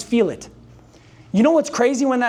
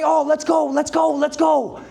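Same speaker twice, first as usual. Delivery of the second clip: higher-pitched fan cheer; excited cheering; 5 semitones higher than usual. Acted. Speaker mimics parents cheering at kids' games, excited cheer. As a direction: chant it like a fired-up sports parent.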